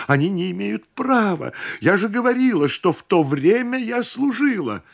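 high-pitched, agitated outcry; indignant protest. A narrator's reading. Cousin insists she was employed, indignantly.

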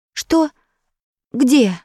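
high, startled questions. A narrator's reading. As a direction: jolt awake confused. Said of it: "спохватился Петя".

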